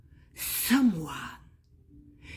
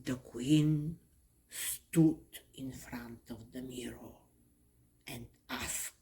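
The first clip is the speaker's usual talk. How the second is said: ordinary delivery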